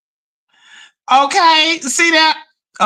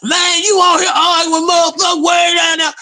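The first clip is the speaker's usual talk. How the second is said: mock scolding shout; 6 semitones higher than usual. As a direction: yell it like family telling you off. Folks scolding her, shouted.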